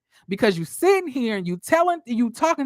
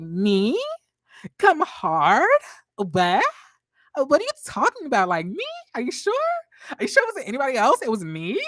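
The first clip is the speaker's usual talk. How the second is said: playing dumb, higher, quick surprised questions; mock innocent Kimmy; 4 semitones higher than usual. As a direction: act shocked like you have no idea. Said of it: "Kimmy's gonna start doing this bullshit".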